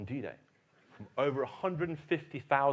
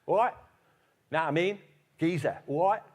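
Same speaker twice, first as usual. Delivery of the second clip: loud, high Cockney greeting; Cockney accent; 7 semitones higher, 9 dB louder than usual. Retold or imitated. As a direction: imitate a matey Cockney veteran, pitched up and loud. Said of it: "he had a Cockney accent. He talked like this".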